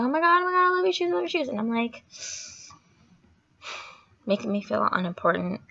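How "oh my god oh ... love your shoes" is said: high gushing squeal; gushing fans. Retold or imitated. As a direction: mimic fans gushing over shoes. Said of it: "Everyone was like".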